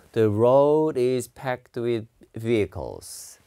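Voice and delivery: "the road is packed with vehicles" said very slowly, word by word; slow pronunciation demo. Shown as a demonstration. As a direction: spell out each word slowly for learners. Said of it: "그죠?". (아주 천천히 또박또박 발음 시범)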